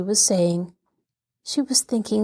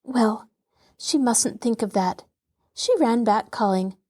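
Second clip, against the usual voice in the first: ordinary delivery, nothing marked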